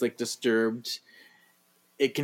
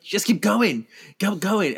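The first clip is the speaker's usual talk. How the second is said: high, louder hurried urging; hammed-up pushing them onward; mock urging; 8 semitones higher, 4 dB louder, slower than usual. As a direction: imitate someone herding a group along. Acted urging the group onward.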